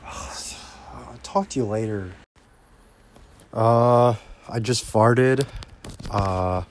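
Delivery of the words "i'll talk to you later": soft whispered goodbye; quietly signing off to the listener. Real, not acted. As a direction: whisper it under your breath.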